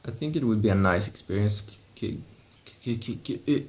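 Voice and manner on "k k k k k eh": stuck stuttering on a k sound; stammering, repeating the k. Real, not acted. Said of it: "I'm stuttering".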